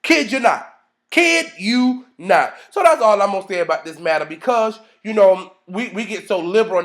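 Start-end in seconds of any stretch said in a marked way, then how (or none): 1.1-2.5 slow emphasis. Real, not acted. Speaker swearing he means it, slow emphasis.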